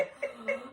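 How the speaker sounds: short, voiceless mock gasp; retold gasp of shock; panicked gasp. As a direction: catch your breath in acted alarm. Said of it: "I'm freaking out".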